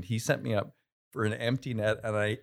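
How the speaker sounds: usual speaking voice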